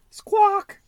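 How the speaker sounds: very high squawk; parrot squawk. Acted. Polly the parrot cries out in a high squawk. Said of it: "cries Polly".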